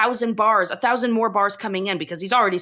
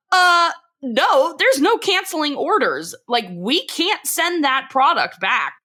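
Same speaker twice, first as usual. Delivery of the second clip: higher-pitched, emphatic mock refusal; acted firm refusal; 6 semitones higher, slower than usual. [name] tells Peggy orders can't be canceled, exasperated. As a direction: imitate someone flatly refusing, voice lifted.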